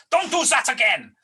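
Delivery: angry voice on the line; angry caller. Retold or imitated. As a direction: scold him down the phone.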